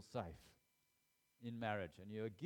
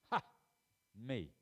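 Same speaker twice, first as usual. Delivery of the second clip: normal talking voice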